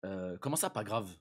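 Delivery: ordinary talk, nothing marked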